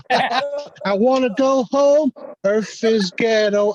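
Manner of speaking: high, slow, whiny drawl; quoting the video girl. Retold or imitated. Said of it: "I was like".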